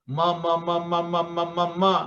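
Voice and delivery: "ma" chanted evenly on one level note; bilabial m. Shown as a demonstration. Teacher demonstrates the two-lip sound "ma", repeated evenly. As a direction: close both lips for each "ma", running them together. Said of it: "Mim juga huruf bilabial".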